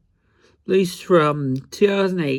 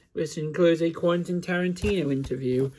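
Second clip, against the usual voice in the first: ordinary delivery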